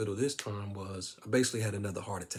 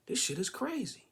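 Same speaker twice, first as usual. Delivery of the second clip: stunned; 9 semitones higher than usual. Retold or imitated. Reacting to near death.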